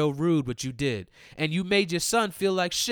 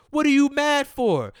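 indignant yell; 11 semitones higher, 7 dB louder than usual. Real, not acted. Ranting at a mom who walked off.

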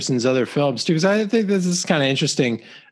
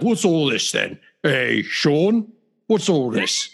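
lightly voiced British dad, rising at the end; a hint of a British dad asking what's going on; British dad impression; slower than usual. Son imitating his British dad's voice, lightly British. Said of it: "It's more my dad's voice".

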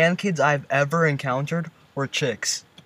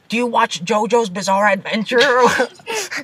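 higher, gushing anime fan; 7 semitones higher than usual. Pretend. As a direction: gush like a nerdy weeb. Imitating an anime fan girl.

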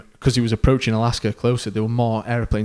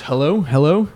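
raised, higher call into the radio; 5 semitones higher, 5 dB louder than usual. Retold or imitated. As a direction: call out anxiously for anyone listening. Calling on the radio, panicked.

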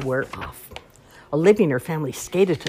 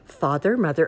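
usual speaking voice

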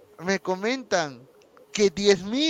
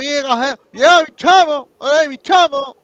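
vendor hyped, high and loud; pregonero de feria; 7 semitones higher, 9 dB louder than usual. Acted. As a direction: shout it like a market barker. Pregón imitado con acento venezolano. (pregonero de feria eufórico, agudo y fuerte)

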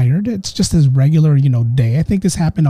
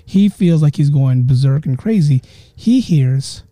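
usual speaking voice

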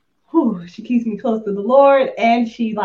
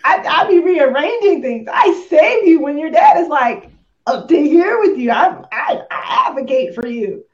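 much higher, louder exasperated mom; exasperated rant; 7 semitones higher, 7 dB louder than usual. Retold or imitated. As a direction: rant at an ungrateful kid. Exasperated mom ranting at kid.

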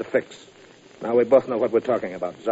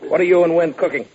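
menacing shout; 5 semitones higher, 6 dB louder than usual. Acted. April's thug roughs Kelly up.

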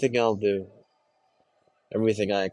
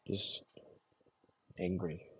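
barely audible; 2 semitones lower, 14 dB quieter than usual. Acted. Trailing confession.

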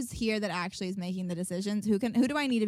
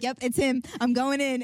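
louder, higher, charging in; determined call-out; 4 semitones higher, 6 dB louder than usual. Retold or imitated. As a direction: announce it like a mission. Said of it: "I'm like".

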